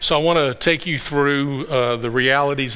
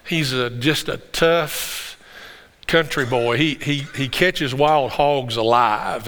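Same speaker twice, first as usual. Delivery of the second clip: normal talking voice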